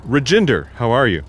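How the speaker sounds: loud, high false cheer; overdone friendly hello; fake cheerful greeting. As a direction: greet him brightly to hide the plan.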